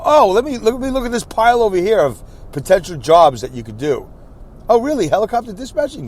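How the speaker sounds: high perky mock clerk. Mimicking the unemployment office clerk checking job listings, high and perky. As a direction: play a cheerful clerk, high-pitched.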